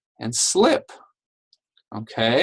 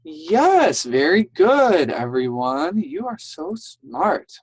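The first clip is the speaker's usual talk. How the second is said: high, enthusiastic teacher cheer; delighted praise; 5 semitones higher, 3 dB louder than usual. Real, not acted. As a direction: praise the class brightly with raised pitch. Teacher praising pupils after their answers.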